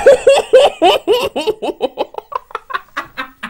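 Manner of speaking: high shrill laugh after the scare; nervous shrieking laugh. Real, not acted. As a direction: laugh off the fright.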